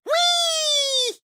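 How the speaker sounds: a very high, ringing squeal of fun; gleeful wheee. Acted. Max launching himself off, squealing with joy. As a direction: squeal like you're on a slide. Joyful cry while flying off.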